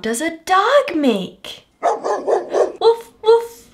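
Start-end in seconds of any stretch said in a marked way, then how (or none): 1.8-3.7 dog barking. Acted. Dog sound for child.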